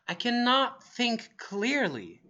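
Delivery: acted confusion; confused. Shown as a demonstration. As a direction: say it slowly, as if muddled. Models a confused expression.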